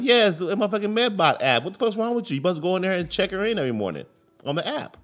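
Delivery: high, exasperated rant at his wife; exaggerated angry rant; mock outrage. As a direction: chew her out for the missed check-in. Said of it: "I'm like".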